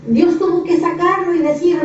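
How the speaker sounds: normal talking voice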